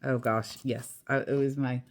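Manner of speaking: ordinary delivery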